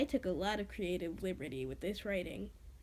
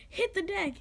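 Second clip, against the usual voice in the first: high, urgent call to duck; a sudden warning shout, pitched up; narrated yell; 8 semitones higher than usual. A narrator's reading. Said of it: "and Sky yelled".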